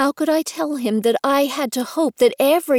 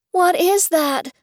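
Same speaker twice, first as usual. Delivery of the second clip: high, slow call over the gale; shouting over storm; 8 semitones higher, slower than usual. A narrator's reading. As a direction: call it out high, drawing the words out. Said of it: "had to raise my mouth to shout".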